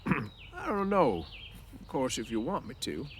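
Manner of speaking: put-on shy old man unsure he's welcome; embarrassed Candy. Candy hesitates at Crooks's door, embarrassed and slow. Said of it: "Candy seemed embarrassed".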